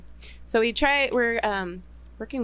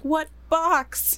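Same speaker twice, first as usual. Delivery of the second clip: retold baffled question; 8 semitones higher, 3 dB louder than usual. Retold reply to a test video.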